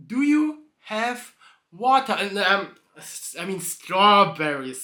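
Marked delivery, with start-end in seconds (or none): 0.1-2.1 higher, slow mock horse voice; horse asking. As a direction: ask it innocently as the horse. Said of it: "So the horse is like".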